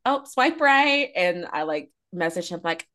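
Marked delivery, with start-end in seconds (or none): 0.0-1.1 high, slow and drawn-out swipe right; flirting with a dating app match, slow and high; flirty. Retold or imitated. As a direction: savour it like a flirty joke. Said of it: "I was like".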